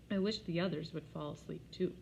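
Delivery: ordinary delivery, nothing marked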